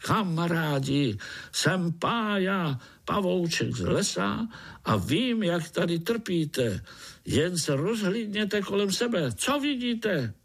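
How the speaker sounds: high-pitched little spider rallying his friends; calling out at full voice. A narrator's reading. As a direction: call out brightly in a high voice. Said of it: "volal tím nejsilnějším hlasem". (volání vysokým hlasem)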